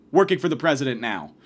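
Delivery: ordinary talk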